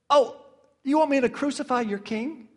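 high, mock-innocent sarcastic question; dripping with sarcasm. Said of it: "that just drips with sarcasm".

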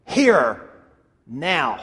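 imitating a firm mother, two separate falling words; curt, final command with a pause between words; foot-down mother. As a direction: lay down the law to an unruly child. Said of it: "she puts her foot down".

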